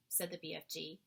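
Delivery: plain reading voice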